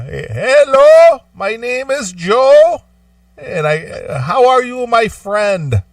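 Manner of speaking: put-on high, slow Indian accent caller; Indian accent impression. Caller imitated to his friend in high Indian accent. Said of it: "thick Indian accent".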